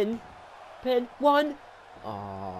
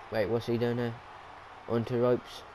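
ordinary talk, nothing marked

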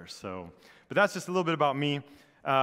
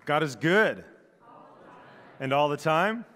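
bright, louder call to the congregation; preacher's call-out; 3 semitones higher, 5 dB louder than usual. Real, not acted. Pastor calling out to the congregation for its response, louder. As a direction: call out the greeting and wait for the answer.

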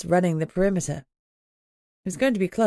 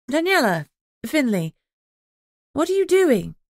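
high, louder surprised what are you doing; startled demand; 8 semitones higher, 4 dB louder than usual. A narrator's reading. Alpha catching pack wolves on the perimeter. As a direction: call out in alarm.

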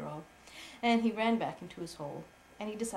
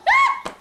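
sudden piercing squeal; a mouse's shocked shriek; startled cry; 17 dB louder than usual. Acted. Mother voicing the mouse's shocked shriek. Mouse faints in bedtime story.

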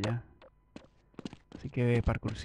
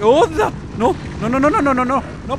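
much higher, louder frantic cries; panicked no's; 12 semitones higher, 9 dB louder than usual. Real, not acted. Gamer panicking at a sudden threat. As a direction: plead in alarm.

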